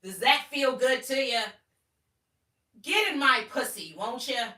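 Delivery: high and loud, almost shouting; loud pushy tone. Shown as a demonstration. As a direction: press the lines like a demand. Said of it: "In this tone, too, by the way".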